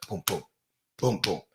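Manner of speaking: short, low double thumps of "boom"; soft thudding heartbeat sound; imitated heartbeat. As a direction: pulse the booms in pairs like a heartbeat. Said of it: "That's the heartbeat and the pulse".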